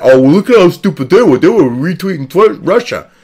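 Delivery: jeering put-on voice, in mimicry; mocking accusers. Vlogger mimicking people who accuse retweeters of Russia links, mocking. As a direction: parrot the accusers with exaggerated scorn. Mocks accusers in acted quote.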